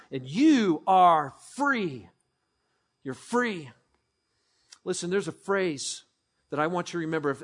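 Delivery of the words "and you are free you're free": tender assurance. Real, not acted. Pastor assuring the listeners gently, slow. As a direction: let it land gently. Slowing after the climax.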